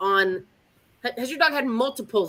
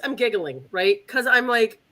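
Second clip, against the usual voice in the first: ordinary talk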